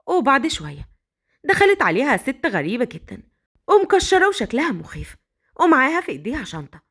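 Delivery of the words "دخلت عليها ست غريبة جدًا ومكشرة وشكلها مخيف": ordinary delivery, nothing marked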